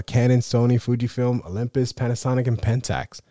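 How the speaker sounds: ordinary talk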